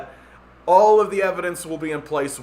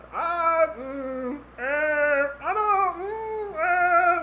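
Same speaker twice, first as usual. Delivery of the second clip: much higher, slow, doubtful hums; skeptical squeak; 14 semitones higher, much slower than usual. Shown as a demonstration. Listener hearing Elon, with high doubtful hums. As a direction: hum up high in disbelief. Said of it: "take more of a, like".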